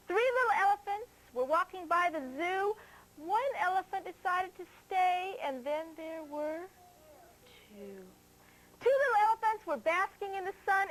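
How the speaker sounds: sing-song counting rhyme; reading to children. Acted. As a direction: read it brightly for little kids.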